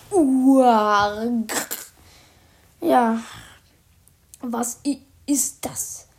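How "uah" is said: loud retching yell; disgusted yell. Acted. Accomplice cries out at the stench, loud disgusted yell. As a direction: cry out at the stink. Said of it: "dann schreit der Komplize".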